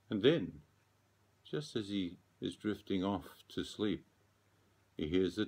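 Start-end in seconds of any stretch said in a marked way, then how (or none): none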